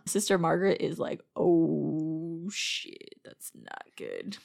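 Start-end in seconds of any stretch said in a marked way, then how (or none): 1.3-4.4 mock dread. Host voices the nun hearing Billy plays Santa, slow mock dread. Imagines Sister Margaret's dismayed reaction.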